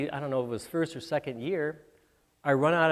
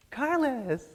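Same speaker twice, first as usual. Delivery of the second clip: high call across a crowded room; calling out a name; 10 semitones higher than usual. Retold or imitated. A young lady calling across the waiting room, high call. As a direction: sing out his name from five rows back.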